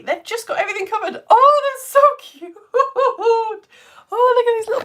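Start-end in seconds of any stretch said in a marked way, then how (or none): 1.3-4.8 gushing. Real, not acted. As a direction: melt over the cute alpacas. Reacting to plasticine alpacas.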